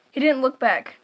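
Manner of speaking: plain reading voice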